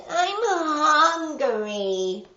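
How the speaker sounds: high, slow, drawn-out whine; whining voice. A narrator's reading. Little gray devil whining to Paper John for food. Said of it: "he cried in a whining voice".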